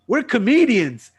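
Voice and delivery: loud, high, drawn-out announcement; imitated proud blurt. Passenger announces their job to the cop, mock-eager.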